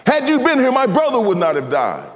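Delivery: high, loud anguished plea; grieving sister's cry. Acted. Martha confronting Jesus, high anguished reproach.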